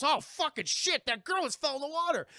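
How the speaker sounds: a hammed-up cry of alarm; alarmed Jack voice. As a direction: react as the startled pirate. Jack reacting in a voice.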